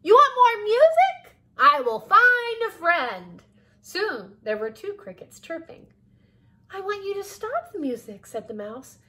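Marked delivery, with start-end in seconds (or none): 0.0-3.4 loud, very high cricket voice; high-pitched, booming little cricket; eager, oblivious cricket. A narrator's reading. Cricket misunderstands, offers more. 6.7-8.2 high, complaining mouse voice; high-pitched plea to stop; protesting mouse. A narrator's reading.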